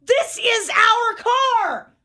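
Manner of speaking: wife mimicking the stranger's words back at her; exasperated insistence. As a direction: fire it back, drawn out and incredulous. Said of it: "And I go".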